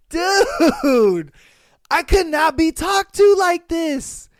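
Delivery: loud, high indignant outburst. Real, not acted. Vlogger reacting to a cheating story, loud and indignant. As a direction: let the indignation burst out.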